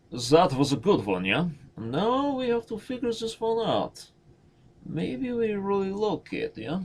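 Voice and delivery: very high-pitched mock Russian accent; squeaky, silly Russian accent. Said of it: "That is my Russian accent".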